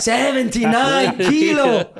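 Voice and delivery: put-on high, very slow Italian-accented "Seventy-nine kilo"; Paolo Di Canio. Italian manager repeating the weight in horror, Italian accent.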